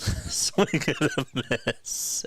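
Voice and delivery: laughing through the call; slow, laugh-choked words. Real, not acted. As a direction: crack up while making the call. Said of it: "I'm laughing".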